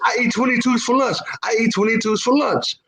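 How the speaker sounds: high-pitched, boastful holler; shouting back. Acted. Tough man boasts to crew below, defiant. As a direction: call down with cocky defiance.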